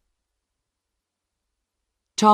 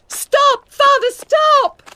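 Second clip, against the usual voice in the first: high, loud desperate scream; 12 dB louder, much slower than usual. A narrator's reading. Daughter screaming at father to stop, high and loud. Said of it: "she shouted".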